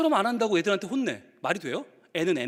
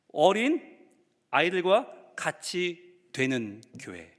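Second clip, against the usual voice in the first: slow solemn; 5 semitones lower, much slower than usual. Acted.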